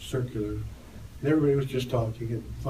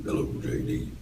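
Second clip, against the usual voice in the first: a very low, breathy bass hello; imitating J D's low voice; 11 semitones lower than usual. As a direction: drop to the bottom of the voice like a bass singer. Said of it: "his low voice".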